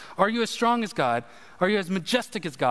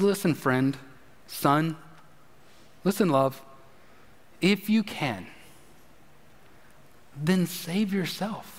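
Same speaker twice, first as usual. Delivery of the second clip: gentle loving God; 4 semitones lower than usual. Acted. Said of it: "in this compassionate, loving way".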